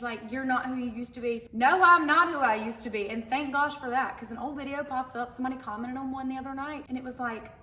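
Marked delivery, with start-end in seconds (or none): 1.5-3.1 louder, firm rebuttal with weight; emphatic reply. Real, not acted. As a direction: push back on the comment proudly. Answering commenters, emphatic.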